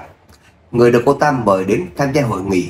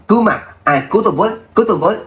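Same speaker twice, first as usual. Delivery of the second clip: high, frantic screams for help; throat-tearing scream; 9 semitones higher, much faster than usual. Acted. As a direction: shriek for help, panicked. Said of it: "gào lên xé họng".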